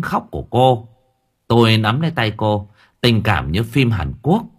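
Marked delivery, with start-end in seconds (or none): none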